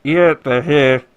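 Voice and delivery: loud, drawled trooper over a helmet speaker; bored guard over external speakers; stormtrooper helmet speaker. Acted. Guard to his squad through the helmet, loud speaker voice.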